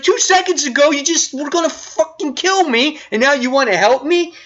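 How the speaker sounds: high, incredulous accusing retort; indignant retort. Retold or imitated. Wonder Woman scorning her attacker's sudden offer, high and indignant. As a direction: throw his hypocrisy back at him. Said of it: "Wonder Woman's like".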